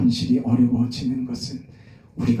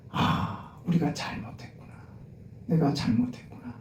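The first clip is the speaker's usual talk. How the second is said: hushed confession; 9 dB quieter than usual. Acted. Quoted repentant confession.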